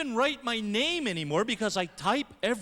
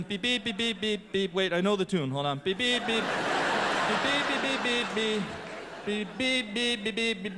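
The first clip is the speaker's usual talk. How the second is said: keypad beep tune. Pretend. Humming keypad tune at payphone.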